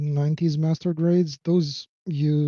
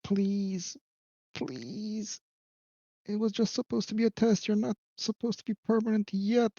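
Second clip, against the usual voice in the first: pleading; 5 semitones higher, 5 dB quieter than usual. Real, not acted. Pleading with a stuck part.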